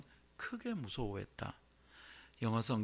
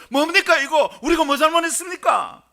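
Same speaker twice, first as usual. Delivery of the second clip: very high, loud panicked questions; acted frightened outcry; 18 dB louder than usual. Preacher voicing shepherds caught in light, acted frightened outcry. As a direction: cry out in alarm at the sudden light. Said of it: "얼마나 겁이 나요".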